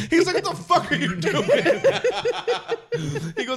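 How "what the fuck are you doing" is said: high, loud mock outrage through laughter; upset. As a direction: imitate a furious boss while cracking up. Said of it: "Michael is so upset".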